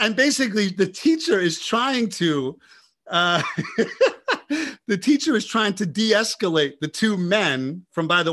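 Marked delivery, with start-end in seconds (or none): 3.5-4.8 real amused laugh; high laugh. Real, not acted. Laughing at own story.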